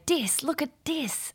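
slow, high imitation of a Lao accent on dis; child's Lao accent, lovingly imitated. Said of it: "the cutest little Layla Dark accent".

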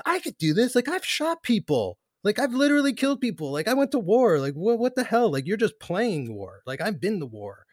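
hammed-up indignant rant; intense mock outburst. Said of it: "intense portrayal".

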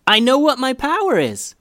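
high, loud announcement; excited yell. A narrator's reading. Sister telling her brother about her new power, yelling excitedly. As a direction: shout out your big discovery. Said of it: "yelled Hallie".

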